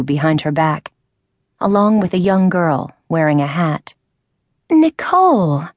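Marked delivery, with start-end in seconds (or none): 4.7-5.6 high excited cry of a name; joyful shout of recognition; cried out. A narrator's reading. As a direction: call out her friend's name in delight. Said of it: "cried the tall woman".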